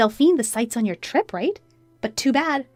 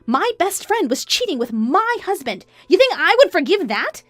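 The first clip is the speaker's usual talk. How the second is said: high, raised, accusing questions; indignant wife; 11 semitones higher, 4 dB louder than usual. Acted. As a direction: turn the blame back on her. Wife turns accusation back.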